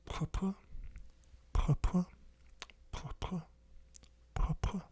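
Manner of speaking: Purple said as French pourpre, with throaty r's; French pronunciation. Shown as a demonstration. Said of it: "Oh, very hard to say".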